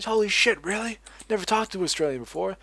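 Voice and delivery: high, amazed gamer gasping holy shit; imitating amazed gamer. Gamer on Vent amazed to meet an Australian, high and astonished. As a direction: play a stunned stranger who's never met an Aussie.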